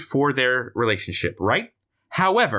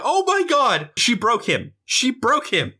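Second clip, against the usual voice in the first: shocked onlookers; 10 semitones higher than usual. Retold or imitated. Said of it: "everyone around him is like".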